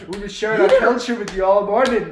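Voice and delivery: a hammed-up thick Scottish brogue, played for laughs; Scottish accent. Friends laughing at the actor's Scottish accent, hammy Scottish brogue. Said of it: "The thick Scottish accent of all time".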